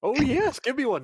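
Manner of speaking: eager, high and loud. Acted. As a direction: grab for the pickle. Character greedy for a pickle.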